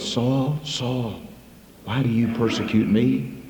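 a quiet call from above; a touch of a distant voice from heaven; heavenly voice. Acted. Voice from heaven calls Saul.

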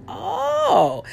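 high, drawn-out "oh"; a sudden dawning realization, voiced high; aha realization. Speaker retelling her wake-up moment, aha realization. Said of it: "It was like a aha moment".

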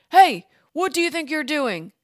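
loud, high angry shout; angry call. A narrator's reading. Men shouting angrily at Maria by the boat. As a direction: shout her down furiously. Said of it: "they called to Maria angrily".

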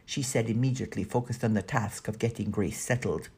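usual speaking voice

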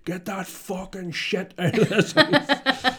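high, slow hammed-up outrage, laughing; mock outraged parent. Dad mocking his own rant at the kids, high and slow. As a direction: yell at the kids over glitter, hammed-up. Kids mocked for glitter fear.

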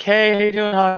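lightly voiced friendly nurse greeting a regular; sweet older nurse. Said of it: "she's super sweet".

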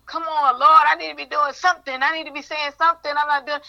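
high, urgent plea; pleading with God. Retold or imitated. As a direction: beg the Lord to give her work. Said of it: "It was like".

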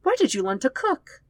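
a hint of the sister's voice; surprised. A narrator's reading. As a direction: caught off guard by the taste.

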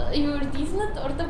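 normal talking voice

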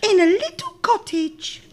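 put-on high, loud childlike reply; little girl voice. A narrator's reading.

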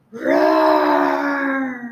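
huge, loud roaring shout; big roar. Acted. Lion teacher demonstrating the roar to his student, full loud roar. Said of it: "roar as loud as you can".

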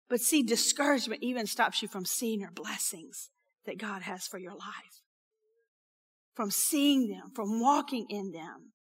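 soft, breathy hushed aside; whisper. Real, not acted. Preacher to congregation, hushed whisper. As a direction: lean in and whisper the warning.

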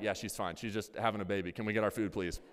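put-on low, soft, offhand "just having a baby"; deadpan nonchalance. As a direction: shrug it off to the cashier. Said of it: "And I'm like".

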